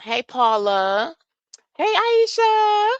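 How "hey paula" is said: ordinary talk, nothing marked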